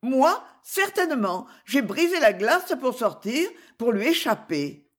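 put-on high, eager girlish reply; high-voiced heroine explaining herself; earnest young lady. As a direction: answer up high, readily owning it.

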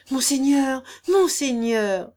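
loud, urgent outcry; exclamation. A narrator's reading. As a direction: cry out to his lordship, drawing out the second call. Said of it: "s'écria le bailli". (exclamation pressante)

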